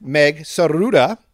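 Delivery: Saruda said with a rolled, trilled R. Real, not acted. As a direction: roll the R in Saruda. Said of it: "I rolled the R".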